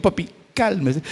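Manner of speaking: quiet, gentle calming of an angry man; soft coaxing. Retold or imitated. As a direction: talk him down softly, like to a child. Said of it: "Chiquito, así le digo".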